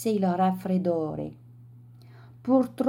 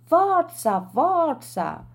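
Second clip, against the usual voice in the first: crowd cheering; 8 semitones higher, slower than usual. A narrator's reading. Tourists cheering the swimmer on, shouted encouragement. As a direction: cheer him on, first call loudest.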